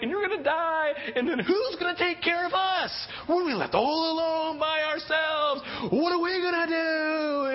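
panicked daughter crying out to her father; panicked child cry. Retold or imitated. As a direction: wail it as a frightened little girl. Daughter's fears peak.

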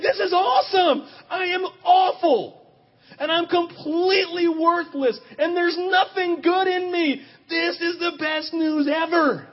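slow ecstatic cry. Retold or imitated. Ecstatic prayer retold.

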